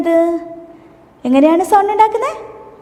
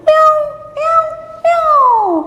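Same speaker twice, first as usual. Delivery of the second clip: very high kitten cries; cat meow; 11 semitones higher than usual. Shown as a demonstration. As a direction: mimic a kitten wailing at the song's end. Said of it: "പൂച്ച കരയുന്നത് എങ്ങനെയാണ്?".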